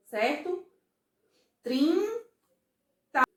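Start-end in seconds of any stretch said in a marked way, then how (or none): 1.6-3.2 "Trinta" split as high, held trin, pause, ta; syllable by syllable. Shown as a demonstration. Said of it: "quantas vezes eu falei as sílabas".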